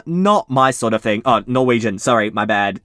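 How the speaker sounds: ordinary delivery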